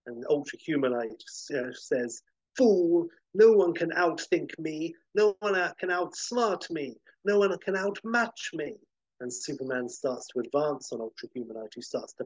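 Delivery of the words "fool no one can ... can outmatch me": higher, louder villain voice, grand and menacing; gloating supervillain. Acted. Ultra Humanite taunts Superman, higher and louder villain boast. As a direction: boast like a mad genius who thinks he's won.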